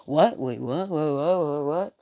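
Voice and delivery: stammered string of rising whats; mild flustered disbelief; caught off guard. Acted. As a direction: stumble over it, thrown off. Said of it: "takes Deku off guard".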